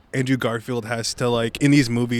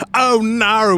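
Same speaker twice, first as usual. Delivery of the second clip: higher mock sob in an English accent; English-accented wail; 7 semitones higher, 3 dB louder than usual. Mimicking actor after the uncle dies, English-accented mock wail. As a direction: imitate a crying actor slipping into English accent. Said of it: "it's like he's sobbing".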